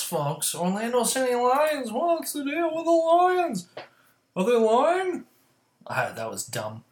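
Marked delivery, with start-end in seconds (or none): 0.5-5.2 high, loud cartoonish announcer; goofy sportscaster voice; hammed-up goofy voice. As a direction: ham up the pun like a cartoon host. Hammed-up pun on Lions.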